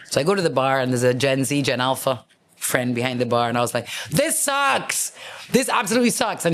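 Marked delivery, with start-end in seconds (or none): 4.1-6.4 exasperated complaint. Retold or imitated. Complaining to bartender.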